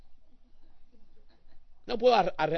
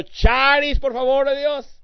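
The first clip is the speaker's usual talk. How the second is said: "Charis, por favor" cried out higher and louder; louder plea; 10 semitones higher, 8 dB louder, slower than usual. Retold or imitated. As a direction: call out "Charis" with rising urgency. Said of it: "El jueves, otro".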